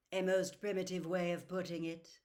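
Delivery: ordinary delivery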